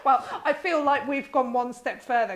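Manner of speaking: talking through a laugh. Real, not acted. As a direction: start the reply still giggling. Laughing at the creepy remark.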